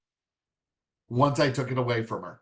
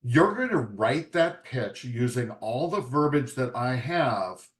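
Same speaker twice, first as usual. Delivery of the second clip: ordinary delivery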